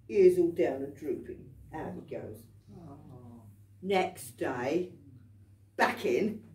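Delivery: usual speaking voice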